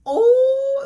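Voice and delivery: high, loud hammed-up moan; steamy-scene moan, mock and loud. Shown as a demonstration. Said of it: "it wasn't really like".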